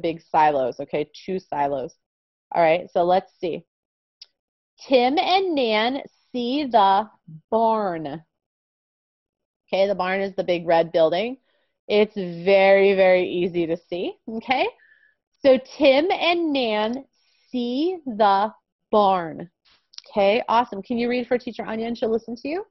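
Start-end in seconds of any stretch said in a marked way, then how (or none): 4.9-8.2 very slow, clear reading; slow reading aloud. Shown as a demonstration. Models the sentence slowly. 15.8-19.5 very slow, clear reading; slow reading aloud. Shown as a demonstration. Models the sentence slowly.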